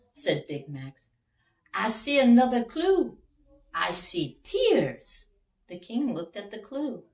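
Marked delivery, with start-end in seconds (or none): 1.7-5.3 louder, then slowing right down on the tears; dramatic detective reveal. Acted. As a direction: build up to the big discovery. Big Max character voice.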